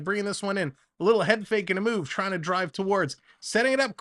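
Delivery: live play-by-play announcer calling a hockey game. Acted. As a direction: call the rush as it happens.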